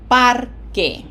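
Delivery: "parque" with a loud, punched "par"; stress on first syllable. Shown as a demonstration. Teacher answering where emphasis goes, "parque" stressed on "par". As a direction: hit "par", let "que" drop in "parque". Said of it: "where is pronunciation emphasis here".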